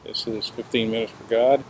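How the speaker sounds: ordinary talk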